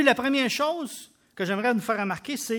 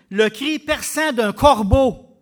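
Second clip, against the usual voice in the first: slow and much louder on corbeau; 1 piercing emphasis; 3 semitones higher, 11 dB louder, slower than usual. Real, not acted. Preacher describing the blind man's cry, loud and slow. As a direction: make the cry ring out.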